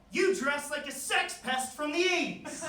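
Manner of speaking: high, drawn-out yell from the crowd; imitated heckler hollering; heckler's yell. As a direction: shout it slowly across a room. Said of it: "I just hear someone yell".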